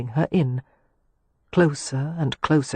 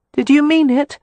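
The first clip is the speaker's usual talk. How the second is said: Catherine, hurt; 10 semitones higher, 6 dB louder than usual. A narrator's reading. To Leo, whom she overheard, a high hurt accusation.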